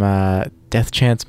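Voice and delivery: usual speaking voice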